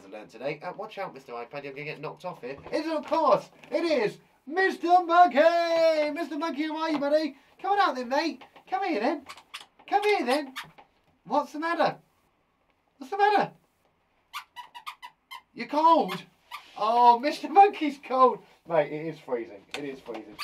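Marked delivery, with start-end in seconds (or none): 2.7-6.1 high, loud drawn-out introduction; showman's stretched-out shout; big announcer entrance. Pretend. 6.1-18.4 high-pitched fussing over a little pet; high, playful coaxing; coaxing a puppet. Pretend.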